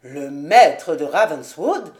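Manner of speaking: the father stunned by the name; surprised exclamation. A narrator's reading. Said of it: "après le premier moment de surprise".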